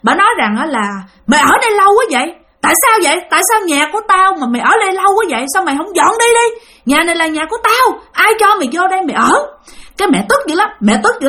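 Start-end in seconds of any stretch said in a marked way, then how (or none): 1.3-9.3 high, rapid tirade in the ghost's voice; retold scolding. The ghost woman demands that mother move out, high fast scolding. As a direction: rattle off indignant questions, pitched well up. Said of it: "bả chửi".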